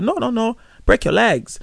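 light imitation of mum in an African accent, saying "legs"; mum's mock African accent. Mum correcting her son, lightly mocked African accent. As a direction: mum insisting "break your legs" in a faintly African accent. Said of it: "You're not even African".

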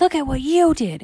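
loud, high-pitched sibling retort; retorting little girl. Acted. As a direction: throw it back in a high girl's voice. Candy throws blame back.

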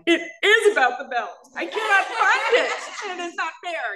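high, half-laughing tearful protest; tearful insisting. Retold or imitated. Arguing with her husband about the belt, high tearful insistence. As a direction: insist through tears, a bit childish.

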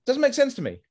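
high-pitched exasperated bewilderment; squeaky bewildered protest; baffled. Real, not acted. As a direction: throw up your hands in disbelief. Baffled by lack of empathy.

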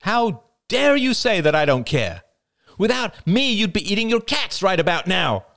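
high, loud furious tirade; furious mother. Acted. Mother berating her son, high and furious. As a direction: let him have it all.

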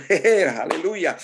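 laughing, lifted hallelujah; excited hallelujah. Real, not acted. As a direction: burst into joyful praise with a laugh. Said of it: "Ooh, I'm getting excited".

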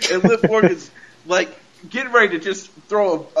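normal talking voice

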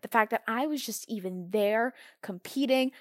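normal talking voice

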